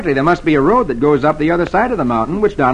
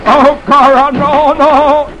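high, loud panicked screech; 10 semitones higher, 5 dB louder, much slower than usual. A narrator's reading. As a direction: shriek it while running from a chair. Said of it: "as he screeches".

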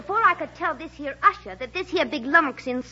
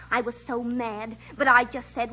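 ordinary delivery

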